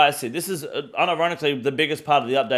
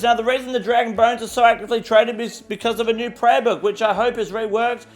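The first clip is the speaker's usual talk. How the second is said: higher, bright newsreader delivery; news anchor voice; 8 semitones higher than usual. Acted. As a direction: read it like a TV news host.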